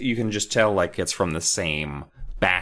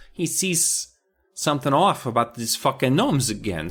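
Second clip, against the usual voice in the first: usual speaking voice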